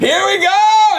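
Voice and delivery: high, loud drawn-out holler; big sustained high yell; hyped announcement. Real, not acted. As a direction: belt it out like a show kicking off. Hyped cue for the next tip.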